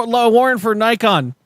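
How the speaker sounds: lightly voiced cop calling through the door; mock cop at the door. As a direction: play a cop banging on the door, not fully committed. Mock police raid at the door.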